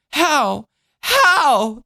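put-on high-pitched little-girl yell, building; shouting child. A narrator's reading. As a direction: demand it like an upset child. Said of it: "the child shouted".

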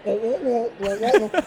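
wordless wah-wah grown-up voice; Peanuts parents. Shown as a demonstration. Podcaster mocking his own accent, muffled cartoon-parent wah-wah. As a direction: honk garbled syllables like a cartoon adult. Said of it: "I'm the parents in the Peanuts".

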